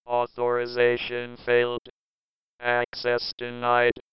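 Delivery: low, slow machine announcer; security system. Acted. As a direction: speak as a door lock computer.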